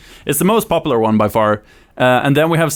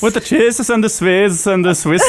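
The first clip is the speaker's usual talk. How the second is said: put-on high singsong caricature Swedish accent; hammed-up Swedish accent; 6 semitones higher than usual. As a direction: play along in exaggerated fake Swedish. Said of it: "you can do the Swedish accent so well".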